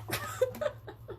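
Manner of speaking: high, airy burst of laughter; breathless high giggle trailing off. Real, not acted. Laughs after agreeing with viewer.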